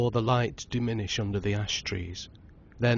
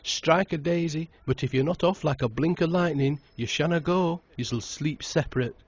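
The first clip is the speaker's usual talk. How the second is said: teasing bluster; 7 semitones higher, 3 dB louder than usual. A narrator's reading. Tom teasing the newlyweds.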